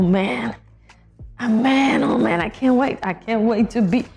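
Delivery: ordinary talk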